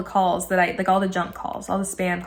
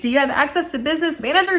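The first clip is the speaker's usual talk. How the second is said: higher-pitched, quick asking; eager question; 6 semitones higher, faster than usual. Retold or imitated. Customer checking with support guy, eager. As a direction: ask it quickly, pitched up but contained. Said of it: "I'm so stoked".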